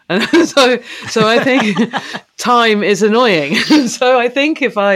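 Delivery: amused giggling between words; laughing while talking. Real, not acted. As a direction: laugh through the words at your own joke. Laughing about the annoying character.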